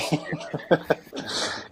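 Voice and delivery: breathy amused chuckle, almost silent; soft laugh. Real, not acted. Interviewer laughing at the Yorkshire remark, soft airy laugh. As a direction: let a quiet airy laugh slip out.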